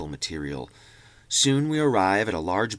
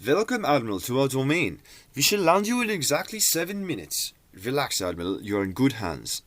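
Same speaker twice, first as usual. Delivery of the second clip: put-on slight German accent; 4 semitones higher than usual. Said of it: "in an English with ... German accent".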